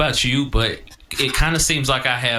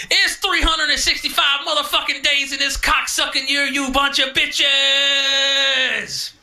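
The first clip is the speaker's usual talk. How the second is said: much higher, drawn-out hype yell; high-energy intro; slower than usual. Retold or imitated. Host yelling podcast intro to prove energy, hyped. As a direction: blast the podcast intro with everything. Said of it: "I hit record and I go".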